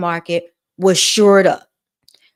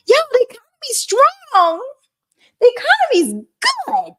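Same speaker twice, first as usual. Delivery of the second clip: much higher, far put-on voice repeating the hype; parroting the news. Vlogger mocks himself repeating news, in a high put-on voice. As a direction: parrot what you heard in a high mock voice. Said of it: "So I'm like".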